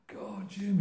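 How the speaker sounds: put-on high-pitched old-timer's exclamation; Len B impression. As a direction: imitate a late old friend calling down from heaven. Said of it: "He looked down on me".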